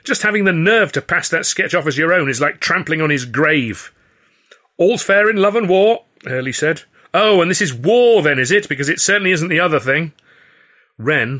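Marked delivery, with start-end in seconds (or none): 0.0-3.9 raised, higher indignant outburst; outraged narrated yell, kept in check. A narrator's reading. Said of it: "I yelled". 4.8-6.1 very high, loud declaration; lofty raised-voice comeback; quarrelsome retort. A narrator's reading. Hurley's retort in heated quarrel. 7.1-10.1 high, loud sarcastic challenge; indignant quarrelling voice; heated retort. A narrator's reading. Narrator's angry retort in quarrel.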